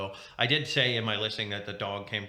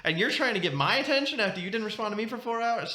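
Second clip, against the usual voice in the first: hammed-up betrayal, pitched way up; mock outrage; 11 semitones higher than usual.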